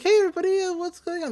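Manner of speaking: high-pitched, mock-casual hello; casual greeting. He greets the clubroom, high and casual.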